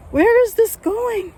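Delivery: real panic in the bushes; scared shriek; terrified. Real, not acted. Repeated in panic.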